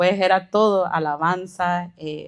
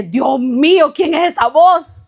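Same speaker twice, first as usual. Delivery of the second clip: much higher and louder astonished cry; amazed pastor; 8 semitones higher, 7 dB louder than usual. Retold or imitated. The pastor overhearing her singing in the bathroom, astonished. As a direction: gasp out the question in wonder.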